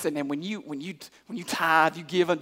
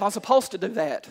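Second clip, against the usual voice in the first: normal talking voice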